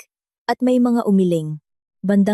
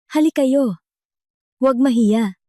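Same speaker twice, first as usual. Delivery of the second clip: higher-pitched host's invitation; lightly voiced emcee calling for volunteers; inviting host; 4 semitones higher than usual. A narrator's reading. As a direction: coax the crowd up, pitch lifted. Host invites people to open mic.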